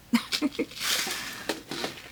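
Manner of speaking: soft, breathy little laugh; soft chuckle. Real, not acted. Gardener amused at having doubled up again, soft chuckle. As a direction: chuckle quietly to yourself.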